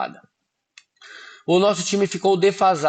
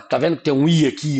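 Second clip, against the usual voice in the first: ordinary delivery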